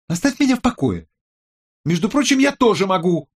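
very high, loud, indignant voice; angry outburst. A narrator's reading. Tech expert tells colleague to back off, high and furious. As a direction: shut the colleague down at top pitch. Quarrel reaches its peak.

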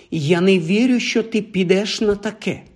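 put-on high-pitched reproachful girl's voice; disapproving girl. A narrator's reading. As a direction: scold in a lightly voiced girl's tone. Hermione voiced, disapproving, ambushing the boys.

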